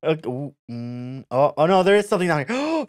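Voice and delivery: normal talking voice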